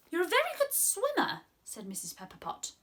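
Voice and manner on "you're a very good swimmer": high, bright little lady voice; small delighted voice; high tiny voice. Acted. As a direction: squeak it up like a tiny woman. Mrs Pepper Pot praising frog.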